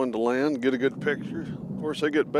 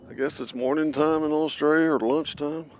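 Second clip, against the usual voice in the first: usual speaking voice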